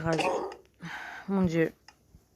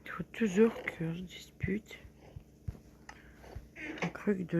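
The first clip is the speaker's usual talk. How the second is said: whispering; 9 dB quieter than usual. Real, not acted.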